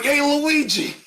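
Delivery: hammed-up Mario greeting his brother; Danny DeVito as Mario. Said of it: "It's like".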